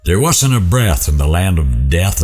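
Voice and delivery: plain reading voice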